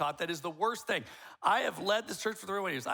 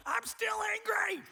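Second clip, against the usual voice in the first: very high, slow squeaky voice; wife insisting she is still angry in a squeaky mock voice; slower than usual. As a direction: mimic the wife still fuming. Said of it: "now she's like".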